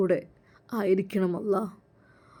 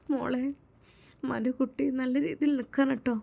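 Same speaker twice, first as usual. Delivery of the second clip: higher, softer loving farewell; a mother's gentle blessing, soft and a little raised; mother bidding her daughter goodbye, higher and soft; tender mother; 5 semitones higher, 5 dB quieter than usual. Acted.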